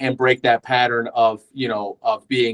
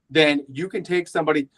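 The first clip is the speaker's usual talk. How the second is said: ordinary talk, nothing marked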